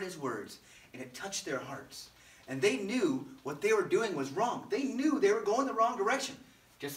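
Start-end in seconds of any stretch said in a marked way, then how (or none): none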